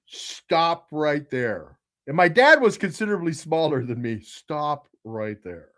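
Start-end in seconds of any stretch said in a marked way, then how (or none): 0.0-1.8 very slow, drawn-out warning; slow retold ultimatum; firm warning. Acts out warning his dad. 4.2-5.7 low, soft and very slow; quiet retold ultimatum, each word drawn out; firm warning. Repeats warning to his dad.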